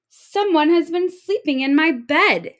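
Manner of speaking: high, loud indignant mother; mother bursting with outrage; mother octopus finds her bed torn; angry mother octopus. Acted.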